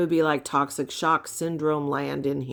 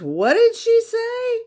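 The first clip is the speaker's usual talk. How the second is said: much higher, louder mock question; husband's baffled question; 6 dB louder than usual. Retelling husband asking what she said, high mock voice. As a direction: squeak it out in disbelief. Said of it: "he goes".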